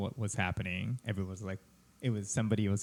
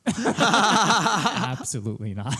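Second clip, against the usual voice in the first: laughing it out slowly, much higher and louder; laughing repeat; 9 semitones higher, 9 dB louder, much slower than usual. Retold or imitated. Comedian laughing while repeating the host's refusal.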